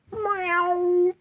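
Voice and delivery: very high meow; cat meow. Shown as a demonstration. Cat meow in a vlog about animal thoughts. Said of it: "think like they sound".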